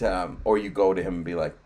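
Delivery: normal talking voice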